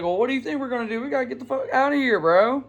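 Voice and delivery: fast frantic retold urging; urgent exasperation. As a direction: rattle it off, we need to run now. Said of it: "I was like".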